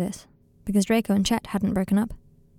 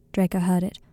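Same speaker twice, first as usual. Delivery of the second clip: usual speaking voice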